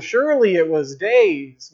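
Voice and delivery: usual speaking voice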